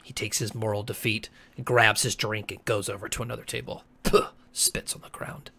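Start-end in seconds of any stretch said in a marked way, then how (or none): 4.0-5.5 spit sound. Acted. Spitting sound.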